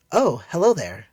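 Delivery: put-on high, cheery, naive young woman. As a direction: greet the stranger sweetly and cheerfully. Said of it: "she said in a cheery tone".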